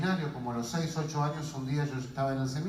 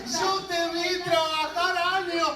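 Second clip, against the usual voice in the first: acted outrage; 11 semitones higher, 4 dB louder than usual. Sibling to woman giving away savings, acted outrage. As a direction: confront her in mock disbelief.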